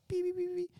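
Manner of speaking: very high mock beeping; metal detector beeps. Friend imitating the airport metal detector, high beeps.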